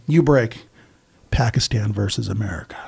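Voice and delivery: hushed, ominous whisper; menacing whisper. Retold or imitated. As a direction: lean in and whisper it like a threat. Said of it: "And he's like".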